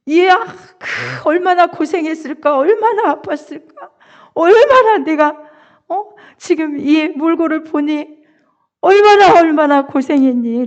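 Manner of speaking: high, loud, bursting with joy; father overcome with joy at his son's return; overjoyed father. Acted. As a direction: gush over the returned son, pitch climbing. Father's overjoyed words retold.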